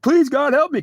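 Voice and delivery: high, loud mock plea; high-pitched cry for rescue; desperate begging. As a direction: act out begging God for help. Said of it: "You'll beg me".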